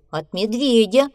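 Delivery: trembling, frightened voice; a shaky nervous answer. A narrator's reading. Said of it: "дрожащим голосом". (дрожащим голосом)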